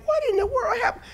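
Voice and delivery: high, crying wail of mock dismay. Preacher imitating a shocked believer, high mock wail. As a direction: cry out in pretend bewilderment.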